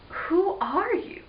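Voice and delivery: slow, high-pitched puzzled question; wondering question. Acted. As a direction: ask slowly, rising in pitch. Eric puzzled by strange girl.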